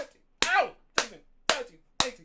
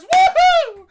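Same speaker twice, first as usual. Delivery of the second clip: high, loud sarcastic whoop; hammed-up cheering whoop; mock cheer; 11 dB louder than usual. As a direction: fake excitement to mock.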